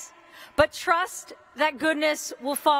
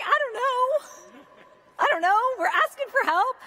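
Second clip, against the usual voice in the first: flustered younger self; 9 semitones higher than usual. Retold or imitated. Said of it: "I was like".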